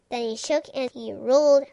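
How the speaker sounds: ordinary delivery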